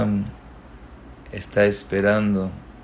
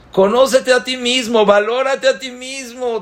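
alto y fuerte, con fuerza; consejo enfático; 12 semitones higher, 3 dB louder than usual. Real, not acted. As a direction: exhorta con vigor. (el predicador aconseja al joven que se valore, alto y enérgico)

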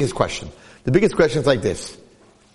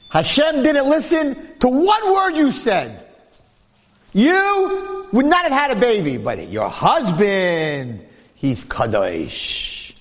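a sage bluntly telling her she was not heard; stern sage rebuke; 8 semitones higher, much slower than usual. Acted. Sage rebukes the praying wife.

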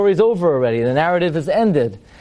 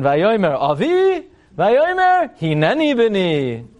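slow, stretched, high theatrical intoning; colonial British stiffness; 4 semitones higher, slower than usual. Acted. Rabbi spoofing the awkward father-son exchange, stiffly British and theatrical. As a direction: declaim it like stiff British drama. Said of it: "what's this awkward, colonial British conversation?".

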